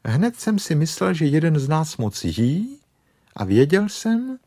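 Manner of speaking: high-pitched reproving rabbit voice; stern rebuke. Acted. Rabbit blames bear for overeating, stern high scolding. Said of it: "řekl králíček příkře".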